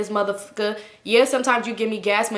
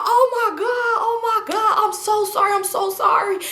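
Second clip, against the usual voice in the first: high-pitched panicky "oh my God", half laughing; imitating a guy who is frantically sorry; flustered apology; 11 semitones higher than usual. Man apologizing after grabbing mom.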